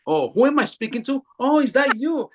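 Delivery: high, light alien voice noticing her; high surprised alien voice; curious alien voice. Retold or imitated. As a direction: voice the alien as it turns to look.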